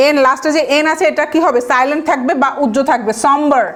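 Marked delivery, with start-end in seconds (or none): none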